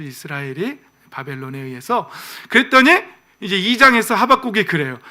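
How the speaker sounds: ordinary delivery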